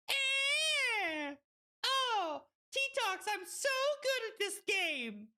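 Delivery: very high, elated outburst; high squeal. Real, not acted. Streamer brags about the game, high squealing. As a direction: squeal it out, carried away. Whooping over own play.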